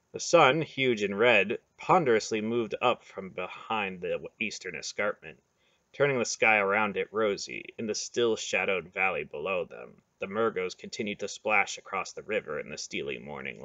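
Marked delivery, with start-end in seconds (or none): none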